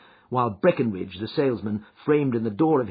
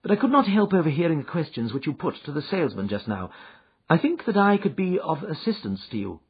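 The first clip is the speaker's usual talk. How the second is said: Holmes, calm and assured; 7 semitones higher, 3 dB louder than usual. Acted. Holmes's voice in narration.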